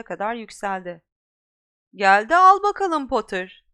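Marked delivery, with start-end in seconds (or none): 1.9-3.6 loud taunting call; Malfoy calling down to Harry. Acted. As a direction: shout the dare from the treetop. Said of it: "diye seslendi".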